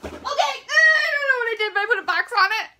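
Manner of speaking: high, loud, tearful panic; freaking out. Real, not acted. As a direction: wail it out in a real panic. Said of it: "I'm freaking out".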